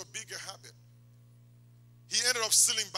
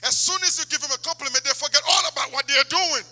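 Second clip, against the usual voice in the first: loud, raised-pitch sermon delivery; preaching at full force; 10 semitones higher, 13 dB louder than usual. Real, not acted. Preacher warning young ladies about false compliments, loud and forceful. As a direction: hammer the warning home loudly.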